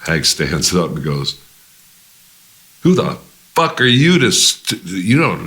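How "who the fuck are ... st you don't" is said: loud, high, furious outburst; angry yell. Retold or imitated. Hag furious at the label head, yelling. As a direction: hurl it at the label boss.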